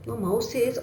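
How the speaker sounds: plain reading voice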